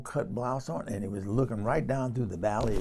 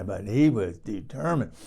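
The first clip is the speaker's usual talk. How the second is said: normal talking voice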